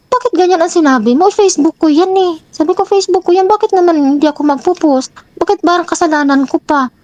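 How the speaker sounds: high, heated protest, replayed; mock-indignant high complaint; indignant retort. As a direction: protest sharply that it's your own Facebook. Retelling her angry reply to partner.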